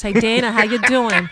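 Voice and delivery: a broad Long Island greeting, slipping back home; thick New York Long Island twang, chummy; Long Island accent. Shown as a demonstration. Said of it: "I can easily start talking like this".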